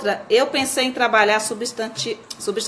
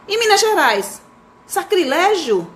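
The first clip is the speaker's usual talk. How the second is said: lightly acted child, high and drawn-out; child questioning; 7 semitones higher, 4 dB louder, slower than usual. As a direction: ask naively in a raised pitch. Child misreads, asks naive question. (criança perguntando, voz aguda)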